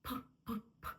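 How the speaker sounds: "П, п, п" as short puffs of air; breathy, separate bursts on "п"; aspirated P. Shown as a demonstration. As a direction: puff each "п" hard enough to move paper. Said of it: "від повітря коливатися".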